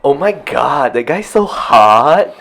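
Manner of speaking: slow, swooning gush over a hot guy; drawn-out, admiring sigh of a line. Shown as a demonstration. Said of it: "You see the way he say is very different".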